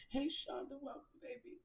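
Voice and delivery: ordinary talk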